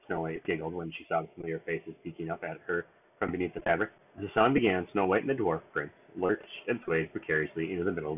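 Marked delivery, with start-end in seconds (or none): none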